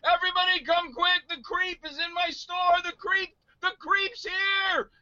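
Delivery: very high-pitched hammed-up yell; imitated excited yelling. Store clerk calling the whole mall over, yelling excitedly. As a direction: call out like a frantic fan summoning a crowd. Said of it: "and he was yelling".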